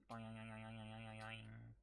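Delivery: low, soft run of boings; bouncing boing noise. Real, not acted. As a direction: murmur a long springy bounce on one note. Imitates the bouncing game character.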